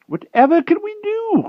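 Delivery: high, loud exasperated wizard cry; cross old wizard crying out in frustration; angry cry. A narrator's reading. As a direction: throw up your hands and cry it out. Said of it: "cried Merlin angrily".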